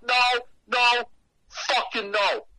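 high, loud string of refusals; emphatic refusal. Retold or imitated. Storyteller rejecting the bedroom invitation, emphatic refusal. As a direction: shoot it down loudly and high.

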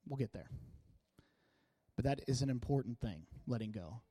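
drops to a soft, intimate voice; softening. Real, not acted. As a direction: bring it down low and gentle. Sermon turns soft and intimate.

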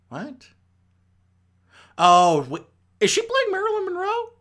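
high, startled realisation; squeaky high surprised double take; sudden surprise. Real, not acted. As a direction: it just clicked, real surprise. Sudden realisation about actress role.

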